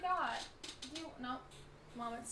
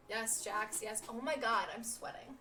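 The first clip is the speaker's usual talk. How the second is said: ordinary talk, nothing marked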